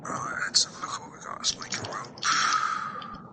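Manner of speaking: soft whisper while browsing a game menu; whispering to self. Real, not acted. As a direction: murmur to yourself while picking items.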